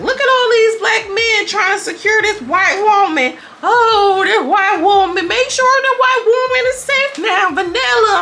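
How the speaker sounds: very high, drawn-out mock fretting; mock alarm. Mocking how security rushed to protect Vanilla, high theatrical alarm. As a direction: wail it in hammed-up, high-pitched alarm. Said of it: "And I'm like".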